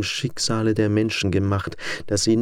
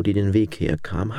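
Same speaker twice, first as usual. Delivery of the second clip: ordinary delivery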